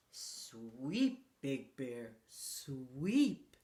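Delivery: put-on low, drawn-out old man's call; low Old Man Winter. A narrator's reading. Storyteller voicing Old Man Winter to Big Bear, low.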